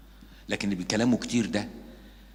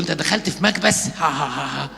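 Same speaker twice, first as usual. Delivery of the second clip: higher, louder taunt with a laugh; mock his thin face; mocking joker; 3 semitones higher, 4 dB louder than usual. Preacher mimics a joker teasing a thin face, loud mockery. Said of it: "يقول له".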